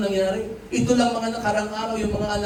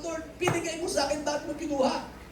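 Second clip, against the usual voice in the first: ordinary talk, nothing marked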